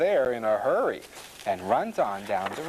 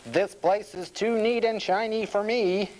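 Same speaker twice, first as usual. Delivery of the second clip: higher-pitched storybook grumble; angry small pig; 9 semitones higher than usual. A narrator's reading. Small pig rejecting the clean pen, angry. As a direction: complain as a cross little pig. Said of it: "He is angry".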